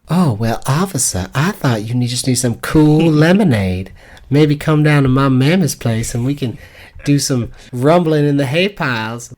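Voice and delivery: hammed-up Southern belle accent, high and breathy with laughter; flirty Southern accent. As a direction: sweet-talk the officer in a Southern belle accent. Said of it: "let's hear your Southern accent".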